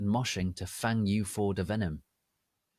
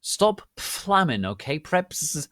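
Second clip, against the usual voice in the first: defiant; 6 semitones higher, 5 dB louder, slower than usual. Acted. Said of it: "I'd notty care".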